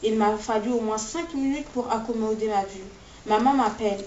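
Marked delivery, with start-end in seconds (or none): none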